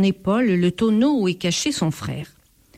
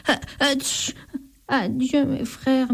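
sniffling farewell; 5 semitones higher than usual. A narrator's reading. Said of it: "murmure le voleur".

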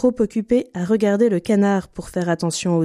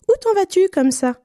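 high piping bird voice; little bird; 12 semitones higher than usual. A narrator's reading. Little bird asking Pierre where he goes, high chirp. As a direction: chirp the question much higher.

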